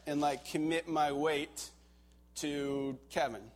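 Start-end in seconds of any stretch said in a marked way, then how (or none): none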